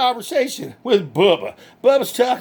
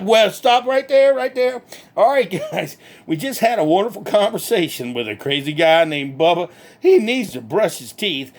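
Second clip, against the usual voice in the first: ordinary delivery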